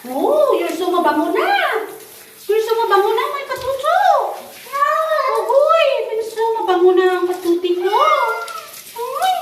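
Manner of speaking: cooing pet talk. Real, not acted. Owner to freshly washed cat, excited cooing. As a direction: coo excitedly, letting the pitch swoop up.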